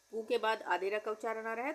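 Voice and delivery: usual speaking voice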